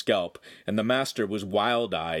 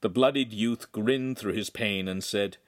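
usual speaking voice

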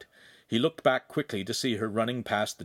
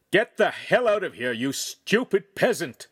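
high, loud furious dismissal; screamed insult; 8 semitones higher, 5 dB louder than usual. A narrator's reading. Noble driving away his companion, angry high-pitched yell. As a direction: drive him off with raised, angry voice. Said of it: "Feltrino screamed".

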